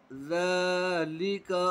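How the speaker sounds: ज़ाली with ज़ा held long; stretched ज़ा. Shown as a demonstration. Teacher stretching only ज़ा for pupils. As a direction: draw out ज़ा, keep ली short. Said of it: "सिर्फ ज़ाल को खींचकर पढ़ेंगे".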